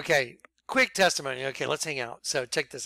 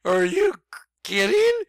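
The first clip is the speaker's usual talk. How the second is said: loud, high-pitched are you kidding; incredulous; 10 semitones higher, 7 dB louder than usual. Retold or imitated. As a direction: crack up into disbelief on kidding. Told to send DVD to Pope.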